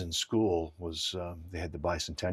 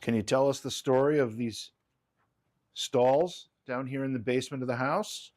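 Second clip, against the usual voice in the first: raised, high voice asking the spirits; calling out; 6 semitones higher, 4 dB louder than usual. Real, not acted. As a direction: project it across the basement. Asking spirits for their story.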